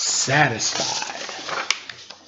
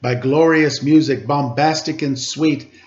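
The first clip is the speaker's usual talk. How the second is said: put-on lively read-aloud to children; storybook reading voice; story narration; 5 semitones higher, 8 dB louder than usual. A narrator's reading. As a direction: read it like a picture book, rhythmic. Reads picture book aloud.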